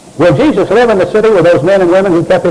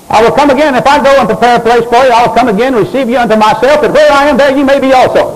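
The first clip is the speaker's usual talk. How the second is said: higher, steady recitation pitch; scripture recitation; 5 semitones higher than usual. Acted. Preacher reciting Jesus' promise to congregation, raised scripture recitation. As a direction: proclaim the verse in an even, raised cadence.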